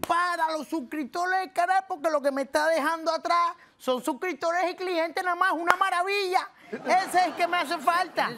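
high, carried-away enthusiasm; excited raving. Acted. YouTuber wants this trainer for his channel, excited raving. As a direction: gush loudly, pitch right up.

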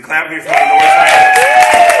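high, slow, drawn-out yell to the crowd; hype man shout. Acted. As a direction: stretch it out like an MC firing up the room. Repeats call-out, hyping crowd.

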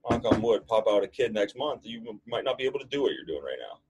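usual speaking voice

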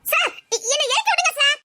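high, pleading cry to be put down; pleading to be let down. Acted. Girl carried off begs the man to put her down, high and pleading. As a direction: beg him to put you down.

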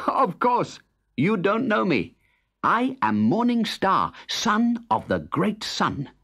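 high voice of Morning Star; high cheerful Morning Star. Acted. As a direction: announce it proudly like a boy. Morning Star reveals himself.

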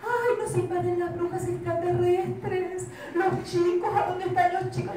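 high, loud alarmed cries; panicking grown-ups. A narrator's reading. The grown-ups panic, searching for the children. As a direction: cry out as if the town is invaded.

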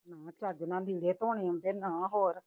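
low, soft grumbling under the breath; mother grumbling to herself over the laundry, muttering low; muttered grumbling. Acted. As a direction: mutter complaints to yourself.

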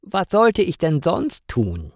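a hint of an odd stranger's voice; costumed stranger. A narrator's reading. Costumed stranger answers the angry woman.

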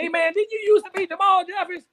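high, loud voice of a guy at the bar; stranger at the bar. Retold or imitated. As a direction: pitch it up high as the curious stranger. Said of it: "He said".

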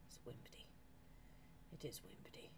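very soft, breath-only murmur; hushed silly muttering; whispered aside. Real, not acted. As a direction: whisper it to yourself. Silly word murmured to self.

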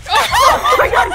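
high, drawn-out shout through laughter; laughing shriek. Real, not acted. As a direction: shriek while cracking up. Laughing shriek in game chaos.

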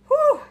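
loud, high puff of relief; short sharp high whew; exhausted whew. Real, not acted. Cook winded from whisking, exhausted whew.

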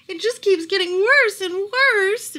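high, loud, slow tearful lament; tearful despair. A narrator's reading. Miss Cream laments her daughter's worsening state, high and tearful. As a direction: let the words fall apart toward a sob. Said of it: "and she began to sob".

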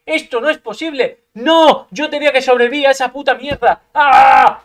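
full-throated furious yelling after a critical hit; furious shout. Real, not acted. Gamer furious at the opponent's second critical hit, screaming. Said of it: "¡Estoy furioso!".

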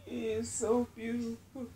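real happy crying, high voice; overcome, crying through the words in gratitude; tearful joy. Real, not acted. Thanking the Lord for her beautiful baby, crying. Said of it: "I'm trying not to cry".

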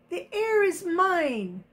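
high-pitched shrieking claim; screaming. Acted. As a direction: scream it possessively. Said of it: "screamed Lydia".

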